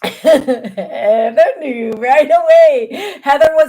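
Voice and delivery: loud, slow words through laughter; real giggly, high-pitched outburst; laughing. Real, not acted. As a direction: crow it through a laughing fit. Still laughing, recounting Heather's typing.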